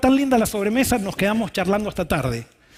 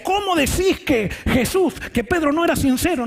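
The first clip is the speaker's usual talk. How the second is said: a host scolding his guest, a touch raised; indignant host; 8 semitones higher than usual. Retold or imitated. Host scolding the pastor at dinner, indignant and higher. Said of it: "él me decía".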